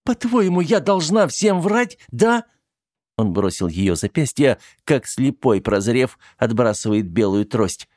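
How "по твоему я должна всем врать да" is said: mocking. Teddy mocks his demand.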